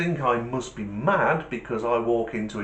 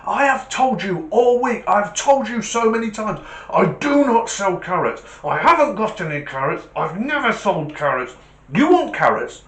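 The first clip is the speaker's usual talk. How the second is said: loud, high, hammed-up furious rant; acted outburst, punching out the words; losing his rag; 9 semitones higher, 6 dB louder than usual. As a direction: rage like a butcher at breaking point. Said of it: "the butcher just loses his mind".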